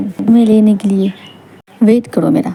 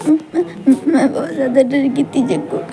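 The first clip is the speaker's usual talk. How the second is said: high, sobbing, broken speech; crying with fear; 4 semitones higher than usual. Acted. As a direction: sob out how scared you were. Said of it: "मत रो". (रोते हुए, डरी आवाज़)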